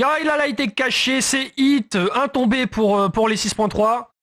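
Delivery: high, hyped-up play-by-play; excited call. Real, not acted. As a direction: call the kill with rising excitement. Caster calls a kill.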